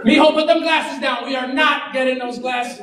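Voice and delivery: scolding mom. Retold or imitated. Mother refusing to buy her son pricey glasses, scolding. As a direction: put your foot down as an exasperated mom.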